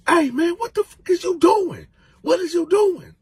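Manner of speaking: high, loud startled yell. Retold or imitated. Freddy yelling at Coco from his room. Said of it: "Freaky Freddy yelled that out".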